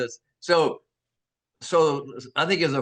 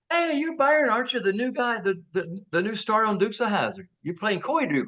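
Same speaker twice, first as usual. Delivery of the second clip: high-pitched excited recognition; retold gushing, pitched well up; imitated starstruck guard; 7 semitones higher than usual. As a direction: play an eager fan at the gate. Voices security guard recognising him.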